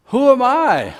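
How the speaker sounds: high, loud rhetorical cry; loud challenge. Acted. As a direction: fling the doubt out to the congregation. Preacher voices listeners' doubt.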